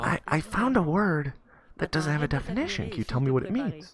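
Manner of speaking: put-on high-pitched little kid asking for help; eager schoolboy. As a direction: play a curious child, pitched well up. Boy appeals to his teacher.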